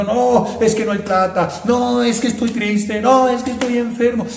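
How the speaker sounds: hammed-up poor-me whining; mock lament. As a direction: mimic someone making excuses with self-pity. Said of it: "Nos lamentamos ... diciendo".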